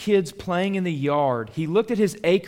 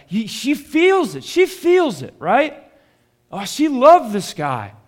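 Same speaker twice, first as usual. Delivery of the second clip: much higher and louder, swept up in it; fervent outburst; 7 semitones higher, 7 dB louder than usual. Real, not acted. Preacher to congregation about the grieving mom, fervent and loud. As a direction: cry it out with passion. Preacher stirred by mother's grief.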